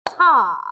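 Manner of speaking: TA said short and punched like a beat; "Ta." one sharp loud beat; rhythm syllable. Shown as a demonstration. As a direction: hit "Ta." as one loud clap. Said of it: "So it makes one sound".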